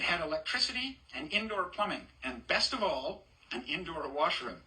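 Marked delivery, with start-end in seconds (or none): none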